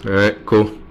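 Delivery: ordinary talk, nothing marked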